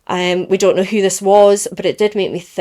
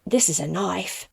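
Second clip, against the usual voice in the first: put-on a film line in a light Australian accent; 6 dB quieter than usual. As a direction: quote the movie in an Australian accent. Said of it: "that was a good accent".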